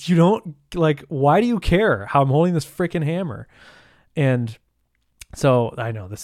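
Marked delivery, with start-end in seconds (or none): 0.1-3.5 exasperated. Retold or imitated. Exasperated rant about neighbor's advice.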